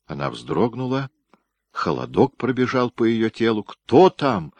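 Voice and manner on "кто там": calling out through the gate, a bit higher and louder; a frightened call toward the gate. A narrator's reading. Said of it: "крикнула она". (окликает через ворота, громче и выше)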